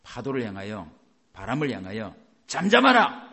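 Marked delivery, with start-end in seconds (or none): 2.5-3.3 loud, higher command; commanding shout. Acted. Preacher acting Jesus hushing the storm, loud and high.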